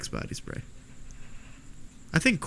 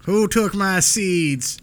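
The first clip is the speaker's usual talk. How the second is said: slow, drawn-out movie line; movie quote; 7 semitones higher, 4 dB louder, much slower than usual. Retold or imitated. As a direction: mimic the film character asking it. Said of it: "That was a line from the movie".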